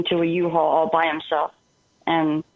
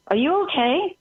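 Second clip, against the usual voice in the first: higher, rising check on someone; incredulous retold question; 9 semitones higher than usual. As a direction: imitate a taken-aback roommate, pitch rising. Roommate doubts her sanity, retold.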